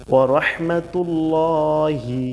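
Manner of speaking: slow, careful Arabic recitation, each syllable drawn out. Shown as a demonstration. Teacher reciting Arabic slowly for learners. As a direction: recite it slowly for students to copy.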